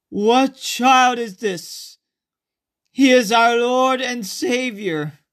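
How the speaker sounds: high, loud, slow astonished church-play voice; hammed-up pageant shepherd. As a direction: belt it out like an amateur nativity actor. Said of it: "he did a lot better than what I interpreted".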